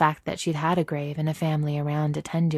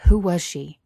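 louder, mildly urgent question; 3 semitones higher, 8 dB louder than usual. A narrator's reading. Son asking Vader who his mother was, urgent. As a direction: press for the answer with some urgency. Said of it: "keeping his voice low as well, but urgent".

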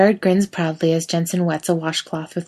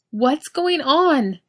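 high, slow, breathless shriek; 9 semitones higher, 3 dB louder, much slower than usual. A narrator's reading. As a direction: shriek it, still panting. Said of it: "Misha shrieks, loud and out of breath".

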